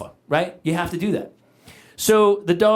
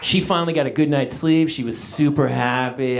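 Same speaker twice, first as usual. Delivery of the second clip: usual speaking voice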